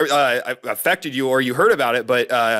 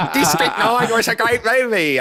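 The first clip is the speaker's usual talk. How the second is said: put-on South African accent; 8 semitones higher than usual. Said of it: "Yours is like an Australian person".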